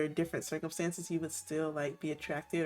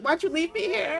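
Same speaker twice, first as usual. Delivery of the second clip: high, louder hurt complaint; whiny complaint; 10 semitones higher, 5 dB louder than usual. Acted. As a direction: friend voiced as upset at being left, high and loud. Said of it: "She's like".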